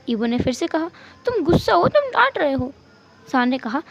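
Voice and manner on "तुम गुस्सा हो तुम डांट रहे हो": high, wailing insistence; upset accusation. Acted. Spouse accusing husband of scolding, high and upset. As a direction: whine it high, refusing to listen.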